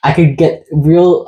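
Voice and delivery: ordinary delivery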